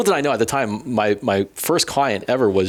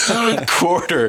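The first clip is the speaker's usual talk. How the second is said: high, laughing through the words; can't hold back the laugh; laughing at own story; 7 semitones higher, slower than usual. Real, not acted. Laughing while retelling the number.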